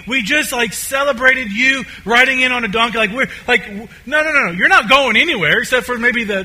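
high, loud incredulous objecting; tense protest. Acted. Disciples refusing to let their Messiah leave, tense protest. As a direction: build from disbelief into agitated, insistent protest. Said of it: "like, this tenseness in them".

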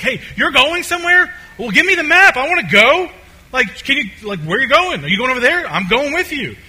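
very high, loud, excited demands; eager Thomas. Acted. Thomas demanding a map so he can come along, eager. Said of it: "Thomas essentially, he wants, he wants a map".

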